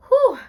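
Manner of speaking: loud high whew of relief; relieved whew. Real, not acted. Shaking off tears for viewers with a loud high whew. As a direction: blow out relief after nearly crying. Relief after nearly crying.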